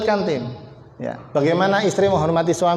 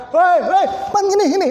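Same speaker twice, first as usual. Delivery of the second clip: imitated yelling; 10 semitones higher than usual. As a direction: bark like a player yelling at a teammate. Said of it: "yang teriak-teriak". (teriakan pemain bola yang ditiru)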